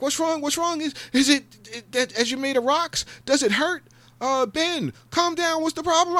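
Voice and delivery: very high, loud concerned mock voice; worried bystanders. Onlookers fussing over Ben, high loud mock worry. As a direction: fuss over him like nervous onlookers. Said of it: "People would be like".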